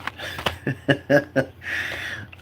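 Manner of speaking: breathy chuckle; soft run of short chuckles; laughing. Real, not acted. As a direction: laugh quietly at the memory. Recalling the funny library moment.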